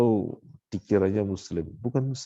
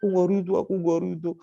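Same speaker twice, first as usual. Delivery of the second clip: higher mock wail, lightly done; mock wailing cry; 8 semitones higher, faster than usual. Preacher repeating the mourner's protest, lighter high wail. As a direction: echo the mourner's cry again. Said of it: "Teriak-teriak".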